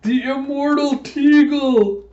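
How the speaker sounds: very high, loud, drawn-out hero cry; triumphant proclamation. Retold or imitated. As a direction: proclaim it to the whole arena. Acting a hero's grand name reveal.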